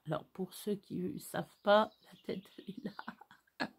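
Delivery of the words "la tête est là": slow unvoiced whisper; hushed slow whisper beside the sleeping dog. Real, not acted. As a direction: whisper it so she doesn't wake. Showing the dog's head again.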